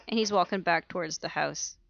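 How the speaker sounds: usual speaking voice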